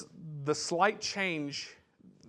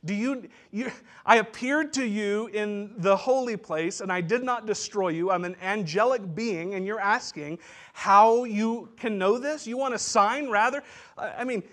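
higher and louder, rising indignation; indignant angel; 6 semitones higher, 6 dB louder than usual. Retold or imitated. Angel rebuking Zechariah, booming and indignant. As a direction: scold him, building in outrage. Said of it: "I was almost saying".

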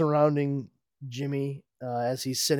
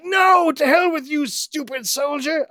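very high, loud villain yell; shrill villain outburst; 9 dB louder than usual. Acted. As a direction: scream at the hero like a cartoon villain. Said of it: "Lex ... is just like".

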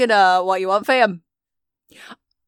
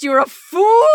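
raised, higher-pitched mock shout; mock outrage; 7 semitones higher, 6 dB louder, slower than usual. Relaying Gary Gygax's insult from the spirit phone, mock shout. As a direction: pass on the insult with theatrical force.

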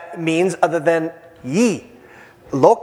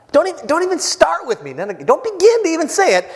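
scolding; 9 semitones higher than usual. Acted. John scolds crowd, paraphrased by preacher.

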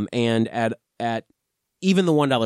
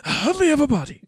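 Voldemort voice; 14 semitones higher, 4 dB louder, much faster than usual. Acted. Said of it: "The Voldemort voice".

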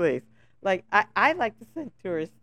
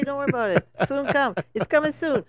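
put-on Crucian island accent, higher and louder; easygoing island accent, voiced high; island lilt; 7 semitones higher, 7 dB louder than usual. Telling a friend how locals talk, in a Crucian island accent. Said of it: "We just say".

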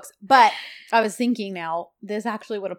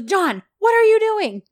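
high, loud indignant cry; mock alarmed protest; 12 semitones higher, 8 dB louder than usual. Woman slung over his shoulder, high mock-alarmed protest. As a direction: protest as if suddenly hoisted up.

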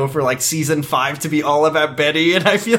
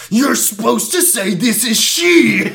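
slow, high, drawn-out mock scolding; hammed-up exasperated boyfriend; Ricky impression; 7 semitones higher, 3 dB louder, slower than usual. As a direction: stretch out each word in indignant correction. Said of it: "Ricky's like".

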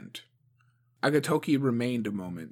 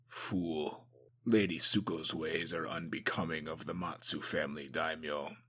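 lower, softer grumble; low disapproving mutter; 6 semitones lower, 7 dB quieter than usual. A narrator's reading. Agatoki grumbles about Suko after she storms out, low. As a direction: shake your head in disdain.

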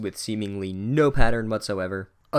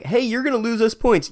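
mock whine; 11 semitones higher, 4 dB louder than usual.

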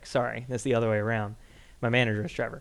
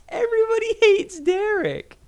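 laughing; 5 dB louder than usual. Real, not acted. Laughing about coworker Derek.

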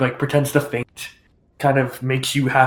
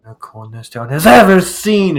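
grand seamstress; 8 dB louder than usual. Acted. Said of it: "She goes".

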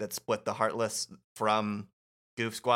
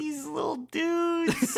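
squeaky cute voice; much slower than usual. Acted. Fond reaction to cute heroes.

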